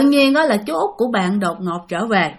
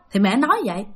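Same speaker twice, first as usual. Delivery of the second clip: ordinary delivery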